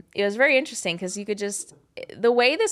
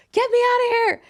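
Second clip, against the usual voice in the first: high, loud yelp; retold panic, blurted out high and loud; panicked cry; 7 dB louder than usual. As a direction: cry out for rescue, voice shooting way up. Panicked plea during bad trip.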